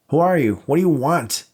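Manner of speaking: high, loud panicked scream. Acted. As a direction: scream it in terror at the intruder. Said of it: "Dave screamed".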